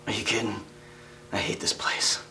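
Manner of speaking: secret whisper; hushed confession out of earshot. Acted. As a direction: lean in and whisper it. Confiding a secret to newcomer.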